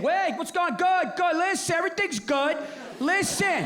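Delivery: high, hearty rushed dad greeting; dad diving in big. Retold or imitated. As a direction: barrel into the call. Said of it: "he would dive in big".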